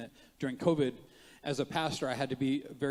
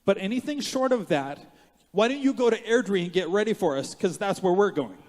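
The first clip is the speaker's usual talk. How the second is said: loud, raised-pitch dare to the enemy; defiant challenge; 6 semitones higher, 7 dB louder than usual. Retold or imitated. Pastor retelling his words to the devil, defiant challenge. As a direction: throw down a challenge, loud and high. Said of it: "I said to the devil".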